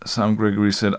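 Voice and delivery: normal talking voice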